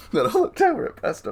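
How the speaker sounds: giggling as he tells it; laughing. Real, not acted. To listener, retelling the story, laughing.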